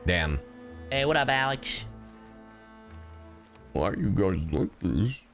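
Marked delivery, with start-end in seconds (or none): none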